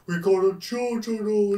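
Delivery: stretched out, slow-motion delivery. Retold or imitated. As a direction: drag the words like a slowed-down film. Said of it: "'cause it's in slow-mo".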